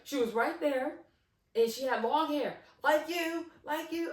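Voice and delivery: high, loud insistence; spooked, emphatic accusation; animated cousin. Retold or imitated. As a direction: imitate him swearing to what he saw. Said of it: "he's real animated".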